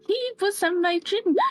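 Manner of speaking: high, breaking into a cry; the repeat cracks into crying; near tears. Acted. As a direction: Gerta crying as she repeats the dream. Terrified after nightmare.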